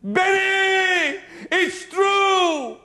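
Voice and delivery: high, loud, excited shout; retold excited scream. Friend screams his discovery to the preacher on the beach. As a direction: yell the news across the beach. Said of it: "Sammy suddenly screams".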